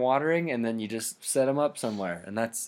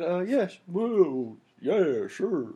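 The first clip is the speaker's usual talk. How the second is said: George W. Bush impression; 4 semitones higher, slower than usual. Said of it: "perfecting his George W. Bush accent".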